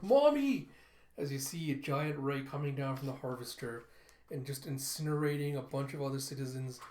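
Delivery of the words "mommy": put-on high, loud child's cry; acted scream for a mother. Child sees the ray hit her mother, crying out. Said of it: "as Telsa cries out".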